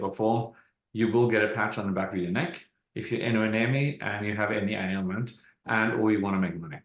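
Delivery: ordinary talk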